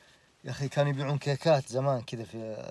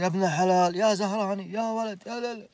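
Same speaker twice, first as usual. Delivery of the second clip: retold shouting; 7 semitones higher, 4 dB louder than usual. Soldiers pulling him off.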